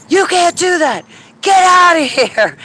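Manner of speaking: high, loud scolding yell, slowing on get out; retold shout. Woman yells at hovering UFO to leave, loud high shout. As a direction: order the saucer away.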